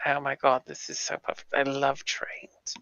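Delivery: soft, whispered gushing to oneself; hushed aside. Acted. Hushed excited aside during the scene.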